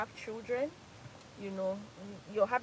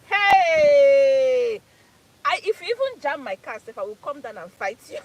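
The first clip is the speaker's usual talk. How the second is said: louder and higher, brash threat; hammed-up road-rage yell; mock fiery threat to a driver; mock shout; 4 semitones higher, 14 dB louder, slower than usual. Retelling her old hot temper.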